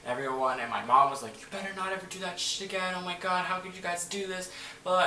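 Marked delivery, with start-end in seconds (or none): 1.4-5.1 high-pitched imitation of an upset mom; scolding mom. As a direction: play the scolding mother, pitched up. Mom scolding after seizure.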